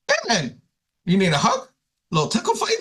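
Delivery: squeaky high, goofy offer; playful Peter. Acted. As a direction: tease him like a giddy buddy. Peter playfully offers Jesus a hug.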